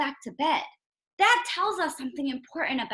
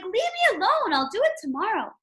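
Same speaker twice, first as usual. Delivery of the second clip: much higher, irritated snap; frustrated; 7 semitones higher than usual. Retold or imitated. Teacher voicing an annoyed Toad to Frog. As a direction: push Frog away, annoyed. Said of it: "He's almost saying".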